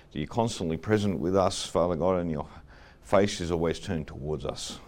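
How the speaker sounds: soft, low prayer voice. Acted. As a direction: pray gently, trailing off. Leads an opening prayer.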